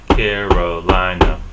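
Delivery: "Carolina" in four slow, loud beats; syllable by syllable. Shown as a demonstration. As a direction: beat out every syllable of "Carolina". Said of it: "That's four beats".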